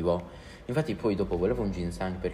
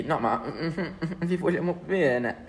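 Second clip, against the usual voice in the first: sappy classmates; 9 semitones higher, slower than usual. Retold or imitated. Classmates voiced sweetly to teachers.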